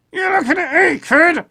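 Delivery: puppet voice. Acted. Puppet repeats the line.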